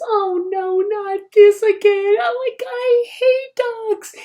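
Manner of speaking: put-on very high, loud old-lady voice, slow and whiny; grumpy old woman. Elderly wife complaining about the yelping dog, shrill mock voice. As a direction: play a fussy elderly woman fed up with dogs. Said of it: "and says".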